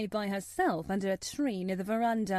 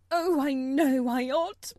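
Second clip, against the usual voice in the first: louder, higher anguished self-reproach; distraught cry; 5 semitones higher, 7 dB louder, slower than usual. Acted. Mother crying out to the officer in distress. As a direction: wail it out in dismay. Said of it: "she cried".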